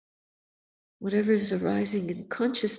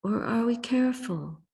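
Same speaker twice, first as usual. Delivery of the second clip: ordinary talk, nothing marked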